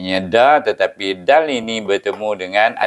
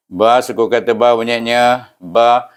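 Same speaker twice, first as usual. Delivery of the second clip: usual speaking voice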